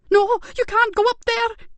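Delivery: very high, loud frantic cry; screaming to stop them; near scream. Acted. Carer shrieking at officers running upstairs. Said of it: "almost screaming herself".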